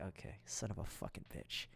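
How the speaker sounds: low, barely voiced grumble; muttered curse. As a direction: curse under your breath, very soft and low. Muttered private reaction to old lady.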